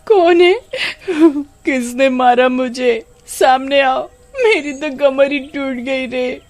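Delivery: high, startled cry, then slow pained wail; startled and hurt. Acted. Kamini knocked down by an invisible slap, high pained cry. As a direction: yelp at the unseen slap, then moan about the back.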